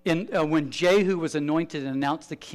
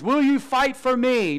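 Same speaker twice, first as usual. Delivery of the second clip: asking the armies; 9 semitones higher, 5 dB louder, slower than usual. Acted. Pastor voicing Jesus rejecting armies.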